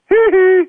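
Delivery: high strangled "Hee hee" while faking a choke; high strangled hee hee, hands on his neck; choking hee hee. Acted. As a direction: squeeze out a high choked giggle. Bus driver mock-choking at woman.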